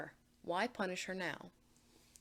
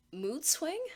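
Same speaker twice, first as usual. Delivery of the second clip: usual speaking voice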